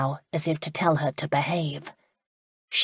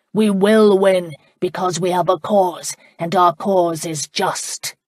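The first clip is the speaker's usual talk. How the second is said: rousing speech; 5 dB louder than usual. Acted. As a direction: rouse the crowd, loud and deliberate. Laird rallies the crowd, roar.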